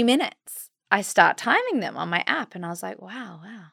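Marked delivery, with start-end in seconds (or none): 3.0-3.6 hushed and much softer, bracing through a wave; soft, winded wow. Retold or imitated. Mother timing contractions on the hill, hushed and winded. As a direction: breathe it out under a contraction.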